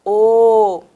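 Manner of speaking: "O" held long and loud with rounded lips; drawn-out rounded vowel "O"; pouted O. Shown as a demonstration. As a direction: pout and sustain "O". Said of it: "a boquinha faz um biquinho".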